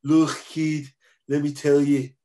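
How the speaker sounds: high, loud imitation of a Liverpool man; emphatic mock Liverpool accent. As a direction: do a hammed-up Scouse accent. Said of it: "That's exactly how he sounds".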